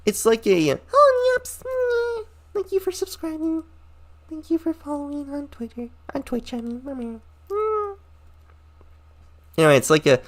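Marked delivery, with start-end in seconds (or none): 0.9-8.0 very high, soft, whimpering thank-you; mock whiny streamer. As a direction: parody a weepy streamer thanking fans.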